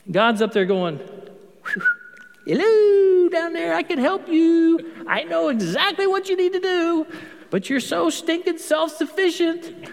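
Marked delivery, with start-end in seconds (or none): 2.5-9.9 high, eager calling voice from above; God calling from heaven, pleading to help. Pretend. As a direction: call down like God trying to get through.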